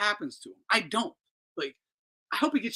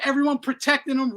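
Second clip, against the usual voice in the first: usual speaking voice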